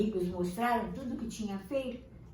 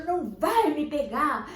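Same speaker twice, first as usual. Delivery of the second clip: brave shout; 5 semitones higher, 7 dB louder than usual. Acted. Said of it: "corajosamente chegou e falou".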